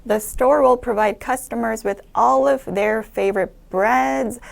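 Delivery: careful, very slow model reading of the sentence; slow read-aloud demo. Shown as a demonstration. Teacher reading a test sentence to students, slow and careful. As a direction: read it out slowly like a model answer. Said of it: "쉼표가 있으니까 한번 쉬어주고요".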